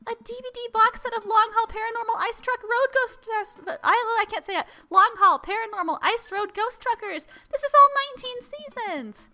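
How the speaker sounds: high, loud tumbling excitement; excited daughter. Pretend. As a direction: gush over the gift, tripping on the title. Voicing Amanda's excitement at gift.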